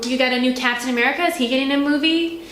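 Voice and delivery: ordinary delivery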